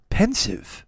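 hushed, near-whispered single word; pensive. Retold or imitated. Hero describes the dog's look, hushed and pensive. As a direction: imitate a considered, whispered verdict. Imitating Cleese's considered word choice.

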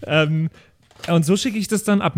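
usual speaking voice

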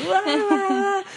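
painful laugh-cry. Pretend. Mimics laugh-crying in a meeting.

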